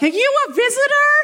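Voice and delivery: very high, sing-song church lady voice; nosy older sister. Retold or imitated. Older sister quizzing a visitor at the door, high and sweet. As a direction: chirp it out like a sweet busybody.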